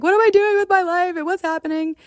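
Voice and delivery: half-mock wail of despair. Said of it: "crying and rolling around in my bed".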